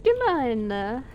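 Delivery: high, hammed-up Italian accent. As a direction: put on a Mario-like Italian accent. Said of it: "with my beautiful Italian accent".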